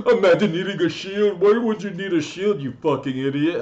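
high, loud, sneering imitation with a laugh; mocking teammate voice. Gamer mimicking teammates who mocked him for asking for a shield, high and sneering. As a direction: ham up a jeering teammate. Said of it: "And they were like".

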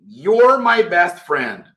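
slow, careful, even delivery; slow classroom demo; slow model phrase. Shown as a demonstration. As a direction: say it slowly so students copy. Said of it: "teacher models slowly".

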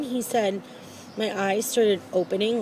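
usual speaking voice

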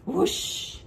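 airy breathed whoosh sound; whoosh cheer. Real, not acted. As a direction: blow out a classroom whoosh cheer. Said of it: "Let's give her a whoosh".

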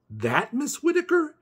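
high squeaky disbelieving question; can't believe it, voice jumping up; disbelief. Acted. Boy aghast at visiting the neighbour, high.

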